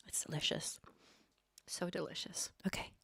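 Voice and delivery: soft unvoiced whisper; hushed savoring whisper. Real, not acted. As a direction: whisper it like a guilty treat. Whispering about the wine.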